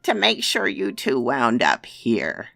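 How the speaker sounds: loud, high cruel crone; taunting witch. Acted. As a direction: twist the knife like a hag.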